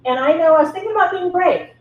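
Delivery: ordinary talk, nothing marked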